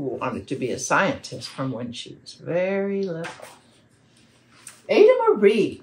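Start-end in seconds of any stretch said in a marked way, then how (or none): 4.9-5.8 high, loud, emphatic name; loud opening call. A narrator's reading. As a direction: announce her name with a punch.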